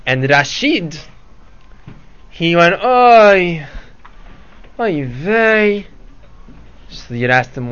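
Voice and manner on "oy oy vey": sighing oy vey, pitched up; yiddish accent. Acted. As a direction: groan it like a lament. Rashid laments missing the dates.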